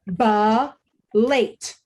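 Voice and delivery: "ba, late" as separate syllables with a pause between. Shown as a demonstration. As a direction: model "ba", then "late", one at a time. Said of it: "tell you the syllables in obnubilate".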